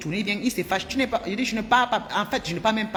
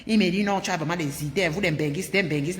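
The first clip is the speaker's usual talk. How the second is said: ordinary delivery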